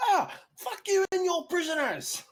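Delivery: very high shouted retort; defiant bandit yell. Acted. Bandit answering the prison guard's threat, defiant yell. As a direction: yell back in open defiance.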